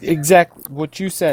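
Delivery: high, cowed agreement; begging for mercy. Acted. Cowed ruffian agrees to Bark's terms, high eager pleading. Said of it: "going to beg mercy at this point".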